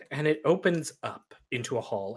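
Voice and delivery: usual speaking voice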